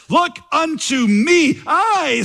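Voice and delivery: high, drawn-out preacher's cry in a mock Essex accent; broad Essex accent. Untaught preacher quoting his text, in a broad mock Essex accent. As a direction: stretch it out high in a country-style Essex accent. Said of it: "in a broad Essex accent".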